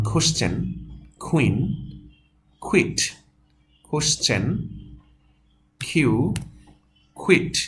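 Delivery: "কোশ্চেন", "কুইন", "কুইট" with aspirated kh; model pronunciation. Shown as a demonstration. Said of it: "খ মিলিয়ে যে উচ্চারণটা".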